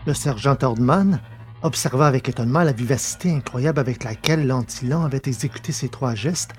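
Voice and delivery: normal talking voice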